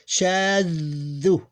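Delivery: "shaddu" with long vowel and held middle consonant; sustained, unbroken demo of "shaddu"; blended without stopping. Shown as a demonstration. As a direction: draw out "shaddu" with no gap between syllables. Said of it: "I didn't stop between the two syllables".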